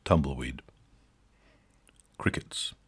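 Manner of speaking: whispered flat aside; whispered deadpan beat. A narrator's reading. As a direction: breathe it into the silence. Dead silence after joke.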